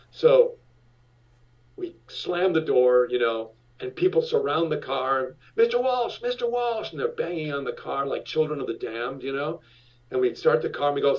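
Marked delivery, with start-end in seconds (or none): 5.6-6.9 high calls of Mister Walsh; imitating fans calling his name. Crowd of fans at the car, calling high and eager.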